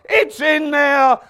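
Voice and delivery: slow, stretched high bellow; bellowed answer. Acted. God answering needy Christians, slow hammed-up bellow. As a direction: roar it, drawing out every word.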